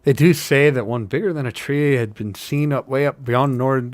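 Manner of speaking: high-starting Irish or Scottish hobbit; wavering Scottish-Irish lilt for a working hobbit; Irish-Scottish accent. Acted. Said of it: "Irish, Scottish with, for Sam?".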